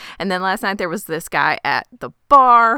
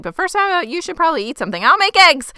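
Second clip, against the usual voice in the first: rising high and bright, covering a lie; hurried cheerful cover-up; overeager nervous host; 10 semitones higher, 4 dB louder, faster than usual. Retold or imitated. Suspicious man to a guest, nervously overeager. Charlie stalling, overly eager host.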